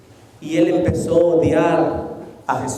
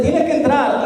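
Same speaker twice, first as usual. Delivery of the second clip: insistent voice; 9 semitones higher than usual. Retold or imitated. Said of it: "una voz le decía".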